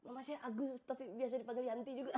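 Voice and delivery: high, timid comic answer; mock frightened. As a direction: plead for your life in a small high voice. Suami ketakutan menjawab maling. (takut dibuat-buat)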